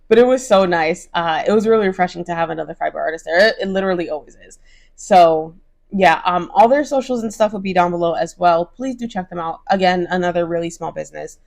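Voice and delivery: normal talking voice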